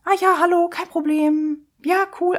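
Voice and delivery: high, sing-song pleasantness; suddenly friendly receptionist. Retold or imitated. Receptionist now a different person with friendly patient, sweetly obliging. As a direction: imitate an easy, obliging welcome. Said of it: "die Arzthelferin ist ein anderer Mensch".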